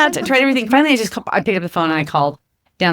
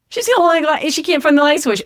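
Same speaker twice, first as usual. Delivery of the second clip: much higher, teasing voice of the hotel guy; amused hotel guy; 8 semitones higher than usual. Retold or imitated. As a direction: tattle on her with glee. Hotel guy tells coworkers story.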